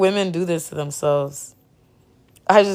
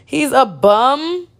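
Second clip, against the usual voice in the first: disbelieving; 6 semitones higher, slower than usual. Real, not acted. Reacting to clip, disbelief.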